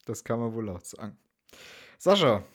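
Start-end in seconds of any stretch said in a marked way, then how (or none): 2.0-2.4 calling out. Real, not acted. Calling co-host's name.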